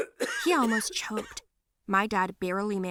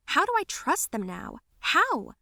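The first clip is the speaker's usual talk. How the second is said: higher-pitched, exasperated questions; distressed questioning; 8 semitones higher than usual. Acted. Girl agonizing over her parents' deception, distressed. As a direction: ask it in anguish, rising on the last word.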